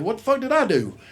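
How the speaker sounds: high-pitched, bewildered panic; comic what-did-I-do protest; alarmed reaction. Retold or imitated. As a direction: yelp the question in mock alarm, pitch jumping up. Panicked reaction as owl flies at her.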